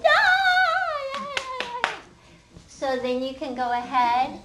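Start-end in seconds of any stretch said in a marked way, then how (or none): 0.0-1.9 high loud sing-song yeah, yeah; much higher, loud sing-song kids' cheer; excited kids. Retold or imitated. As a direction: imitate kids excitedly catching on. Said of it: "they're going".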